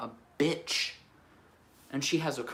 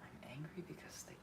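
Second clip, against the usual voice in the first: whisper; 3 semitones higher, 15 dB quieter than usual. Real, not acted. Repeating thought to herself, whispered.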